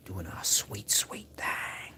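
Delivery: breathy ASMR whisper. Shown as a demonstration. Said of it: "she's A S M R ing".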